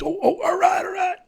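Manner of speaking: high squeaky mock delight; giddy excitement. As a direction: squeal it up high like a gullible kid. Kid hears he'll get paid.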